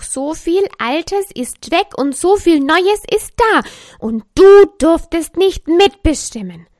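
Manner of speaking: the Wut fuming but on Lisa's side; die Wut. A narrator's reading. (die Wut schimpft laut mit Lisa mit)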